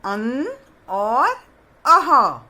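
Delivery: अन drawn out slowly, then अह thrown out loud; teacher sounding out अन and अह; naming the vowels. Shown as a demonstration. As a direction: stretch अन, then punch out अह. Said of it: "औ के बाद कौन सी मात्रा आएगी?".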